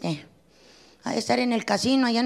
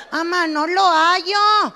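put-on higher, slow little-girl plea; mock timid daughter; meek child voice; 4 semitones higher, 3 dB louder, slower than usual. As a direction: whimper it like a scared kid. Child answering mother.

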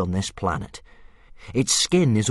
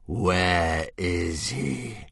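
very slow monstrous voice, gathering strength; stronger dragon hiss; 2 semitones lower, 3 dB quieter, much slower than usual. Acted. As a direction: menace rising as the dragon rallies. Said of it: "hissed a little more strongly".

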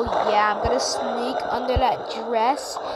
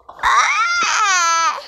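pained cry; 6 semitones higher, 5 dB louder than usual. Pretend. Game character cries out.

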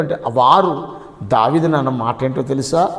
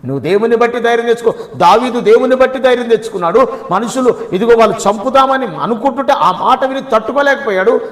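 higher, louder sermon at full force; fervent preaching; 6 semitones higher, 4 dB louder than usual. Acted. Pastor rousing the congregation about David's secret, fervent preaching. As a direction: proclaim it to the congregation.

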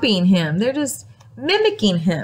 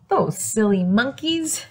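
plain reading voice

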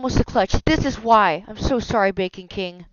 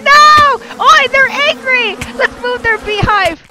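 loud, high, drawn-out yell; panicked shout; 11 semitones higher, 8 dB louder, slower than usual. Real, not acted. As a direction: yell in alarm as bees swarm. Bees attack the player.

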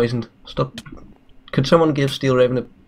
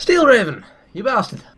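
shouting; 10 semitones higher than usual. Acted. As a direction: yell it in playful outrage. Mock outburst at knighted player.

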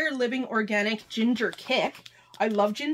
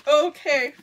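normal talking voice

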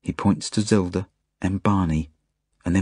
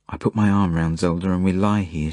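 usual speaking voice

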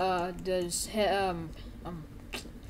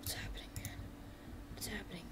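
very soft, nervous-character whisper; whispered bewilderment; 18 dB quieter, faster than usual. Acted. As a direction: act him out under your breath. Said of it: "He's like".

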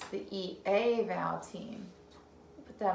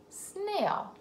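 "Snail" said quickly and much higher; bright quick read; 10 semitones higher than usual. Shown as a demonstration. Teacher reading "Snail" quickly to the child. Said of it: "S N blend at the beginning".

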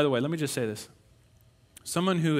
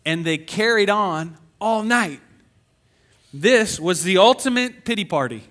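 higher, louder preaching at full force; 7 semitones higher, 8 dB louder than usual. Acted. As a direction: preach it out big to the crowd.

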